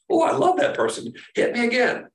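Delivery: high-pitched, gushing mock devotion; mock adoration. Preacher mimicking a moron who loves the abuser, mock adoration.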